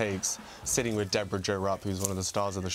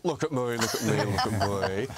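laughing Aussie Kath and Kim catchphrase; broad Australian sitcom voice; Australian accent. Shown as a demonstration. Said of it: "how's your Australian accent".

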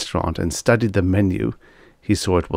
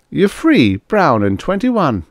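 higher-pitched, slowed insistence; urging her; 10 semitones higher, 3 dB louder than usual. Acted. Trucker tells the girl she can leave, urging. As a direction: spell out her freedom, drawn out.

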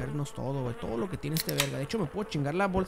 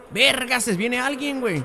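alarma; 6 semitones higher, 7 dB louder than usual. Real, not acted. Alarm, someone is coming.

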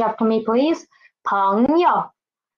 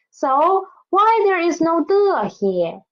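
why raised high, 的 leaned on; stress on 的; 6 semitones higher than usual. Shown as a demonstration. Teacher posing a puzzle to students, raised. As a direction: ask it up high, leaning on 的. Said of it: "please remember this question".

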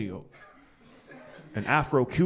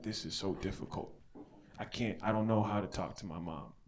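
awkward mumble; 6 semitones lower, 14 dB quieter than usual. Retold or imitated. Awkward sober self talking to mom.

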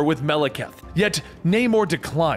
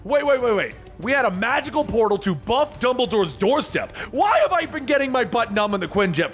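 demanding; 7 semitones higher than usual. Acted. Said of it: "Deadpool demands".

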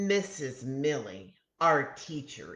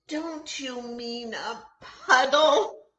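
giggling; 9 semitones higher, 5 dB louder than usual. A narrator's reading.

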